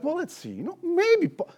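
mock wishy-washy, high and soft; hammed-up noncommittal maybe; vague hedging. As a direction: dither like someone who won't commit. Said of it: "he wasn't vague about it".

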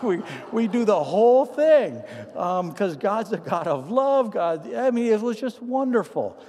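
high, loud enthusiastic telling; excited. Real, not acted. Telling the church about the dog prayer, rising excitement. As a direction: gush about the moment.

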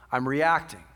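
ordinary delivery

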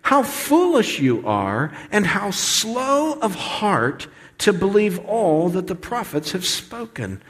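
slow, high, drawn-out scripture reading; Jesus admonishing. Acted. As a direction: chide them deliberately, stretching the key words. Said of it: "He said to them".

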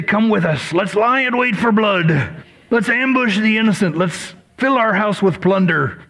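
lightly voiced pirate, a touch of growl; playful mock pirate reading. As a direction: read the verse like a hint of a pirate. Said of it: "Doesn't that sound like pirate language".

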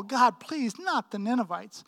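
ordinary talk

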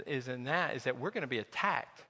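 ordinary talk

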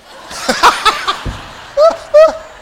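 big high loud laugh; high shrieking laugh. Real, not acted. After the waiter's punchline, a big high laugh. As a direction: break up at the punchline.

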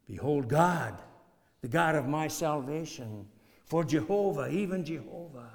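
emphatic scripture reading. Acted. Preacher reading the verse.

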